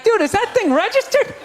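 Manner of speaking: high-pitched, incredulous friend in a panic. Acted. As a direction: pitch it up, urgently warning a buddy.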